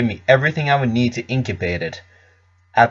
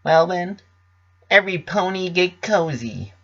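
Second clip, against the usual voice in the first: put-on chipper pony girl inviting friends to settle in; cheerful storyteller's invitation, pitched up; Pinkie voice, higher; 7 semitones higher, 4 dB quieter than usual.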